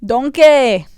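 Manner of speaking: put-on loud Scottish accent, like Shrek; Scottish accent, shouting Donkey; Shrek's Scottish accent. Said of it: "Can you guys do Scottish accents?".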